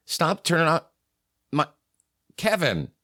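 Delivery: high, whining complaint; whiny guy. Retold or imitated. On air, whining at Kevin to stop cutting the mic. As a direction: whine at Kevin like a put-upon sidekick.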